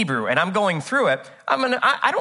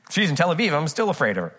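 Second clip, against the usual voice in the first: usual speaking voice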